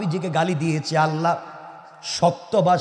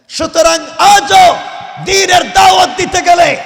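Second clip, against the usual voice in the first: high, shouted sermon; full-force preaching; 10 semitones higher, 12 dB louder than usual. Real, not acted. Preacher warning that insiders oppose the faith most, full-force preaching. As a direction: thunder the warning out to the crowd.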